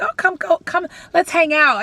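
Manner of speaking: high-pitched mock eager invite; eager invitation. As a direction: imitate overeager college friends making plans. Mimics college friends' eager invitation.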